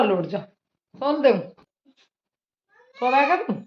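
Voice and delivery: a mother cooing to her crying daughter, high and gentle. Real, not acted. Mother coaxing her sick daughter.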